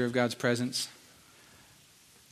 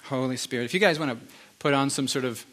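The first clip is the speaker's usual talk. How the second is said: normal talking voice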